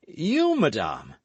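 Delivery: leaping high on You, then dropping; mock astonishment, high You; feigned surprise. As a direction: play shocked, a little too much. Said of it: "affecting surprise".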